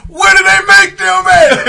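high, loud, laughing holler; laughing shout. Retold or imitated. Jimmy loses it over the woman, loud laughing shout. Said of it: "Jimmy lose it".

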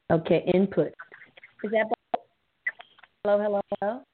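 usual speaking voice

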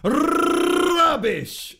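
high, slow, stagey show opening; hammed-up posh welcome, slowed right down; mock grand intro.

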